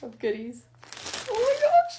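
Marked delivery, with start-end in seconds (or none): 1.3-2.0 very high, loud squeal of alarm; sudden high-pitched outburst; startled shriek. Real, not acted. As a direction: yelp it out, pitch shooting way up. Startled while handling a heavy package.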